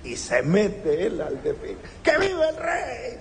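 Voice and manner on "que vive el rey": high cheer for the king; much higher cheer; joyful cheer. Retold or imitated. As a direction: shout it marching in the parade. Beggar joins parade cheering.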